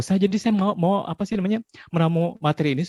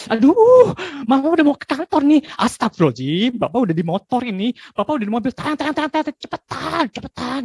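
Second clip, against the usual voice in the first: much higher, loud hurried yelling; frantic rushing parent; 11 semitones higher, 5 dB louder than usual. Retold or imitated. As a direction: panic and hurry the kids out the door. Rushed parent yelling before work. (ibu panik berteriak menyuruh anak cepat)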